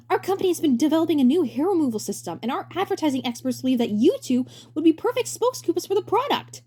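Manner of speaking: bright high showman voice; high cheery director voice. Acted. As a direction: play the grinning director, way up high. Director character voice.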